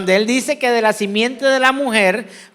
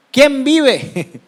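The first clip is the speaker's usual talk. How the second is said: playful high rallying call; charismatic preacher; 6 semitones higher, 4 dB louder than usual. Pretend. Preacher leads congregation in playful charismatic call, raised voice. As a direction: call out like a charismatic, half joking. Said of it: "Vamos a decirlo como los carismáticos".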